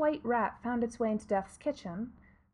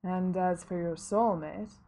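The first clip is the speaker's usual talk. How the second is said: low, slow reaper voice; slow and measured, as Death; grim reaper voice; 3 semitones lower, slower than usual. Acted. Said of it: "said Death".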